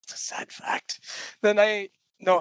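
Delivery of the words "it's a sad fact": soft whispered confession; whispered aside. Real, not acted. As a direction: whisper it like a secret. Unvoiced aside.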